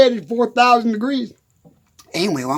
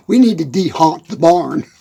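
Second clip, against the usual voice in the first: Buttermilk; 7 semitones lower than usual. Retold or imitated. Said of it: "He says".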